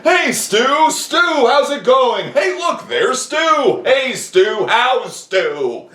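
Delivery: high, loud cheery calling out; hammed-up crowd hailing Stu; mock eager greetings. Imitating convention-goers shouting to Stu, high and loud.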